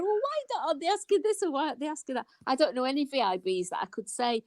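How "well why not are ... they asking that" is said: high, hurried mock questioning; frustrated viewer at the questioners, high rushed quoted protest; retold questioning. Rattle off the quoted questions high and fast. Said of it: "I was going".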